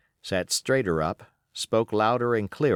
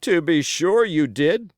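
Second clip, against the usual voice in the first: loud, high, slowed pirate's cry; wounded pirate crying out to the boy; 8 semitones higher, 6 dB louder than usual. Acted. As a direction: burst out in grudging agreement. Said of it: "he cried".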